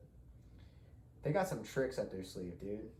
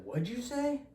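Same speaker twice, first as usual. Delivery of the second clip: high, loud, mocking tough-guy challenge; hammed-up high-pitched imitation of an angry stranger; aggressive challenge; 4 dB louder than usual. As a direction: squeal it out like an offended drunk picking a fight. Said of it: "and he's like".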